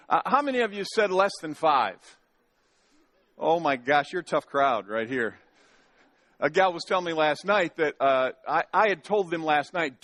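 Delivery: normal talking voice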